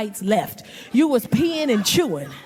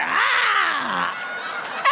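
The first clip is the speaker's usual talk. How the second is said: mock yelp; 5 semitones higher than usual. Bouncing in the air in car.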